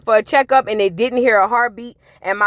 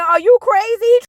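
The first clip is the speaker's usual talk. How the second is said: mock outburst; 8 semitones higher, 4 dB louder than usual. Vlogger yelling at the husband on TV. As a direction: pitch jumps up, louder, incredulous.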